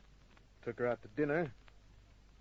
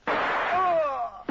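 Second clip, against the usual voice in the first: startled cry; 7 dB louder than usual. Acted. Kent reacts as gunfight starts.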